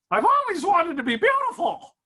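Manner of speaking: very high-pitched caricature woman; ugly woman voice. Acted. Ugly woman asking God for a wish, very high caricature voice. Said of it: "that's what an ugly woman sounds like".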